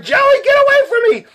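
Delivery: loud, very high mock screech; exasperated mother yelling. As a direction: yell like a mom at her wits' end. Harried mother yelling at her kids.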